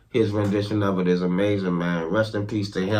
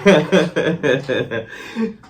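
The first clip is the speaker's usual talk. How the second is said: laughing; 5 semitones higher than usual. Real, not acted. Laughing at own joke.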